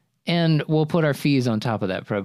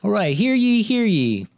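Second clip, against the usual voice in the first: high, loud proclaiming crier voice; town crier; 10 semitones higher, 4 dB louder than usual. Pretend. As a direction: announce it like a town crier from a box. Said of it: "That's [name] getting on top of his box".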